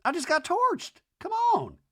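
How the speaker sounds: high, pleading mock whine. JT pleads with Whitney in the van, high mock whine. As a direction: squeak it out like he's been gutted. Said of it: "And J T goes".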